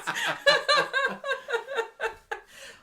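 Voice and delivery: high, long laugh; loud laughter. Real, not acted. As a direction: burst out laughing. Laughing at own joke.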